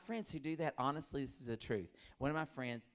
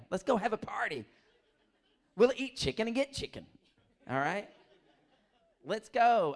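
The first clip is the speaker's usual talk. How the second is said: loud, high, laughing party call; mock party hype; 8 semitones higher, 8 dB louder than usual. A comic hyping chicken pox parties, loud and laughing. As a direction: sell the sick party with a laugh.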